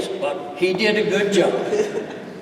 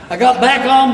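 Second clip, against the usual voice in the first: plain reading voice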